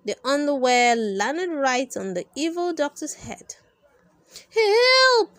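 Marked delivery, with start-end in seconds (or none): 4.5-5.3 high, loud helpless shriek; panicked cry for help. Acted. Blinded villain crying out for help. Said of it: "cried Doctor Dapper".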